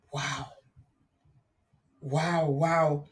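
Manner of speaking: low, hushed stunned wow; amazed wow. Real, not acted. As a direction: let it sink in quietly. Reacting to a painful call.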